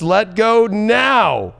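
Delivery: usual speaking voice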